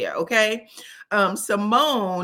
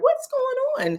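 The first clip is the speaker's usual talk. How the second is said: much higher, innocent and giggly; clueless giggly voice; slower than usual. Retold or imitated. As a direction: play it sweetly clueless while insults fly past. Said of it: "she was kinda laughing, giggling and smiling".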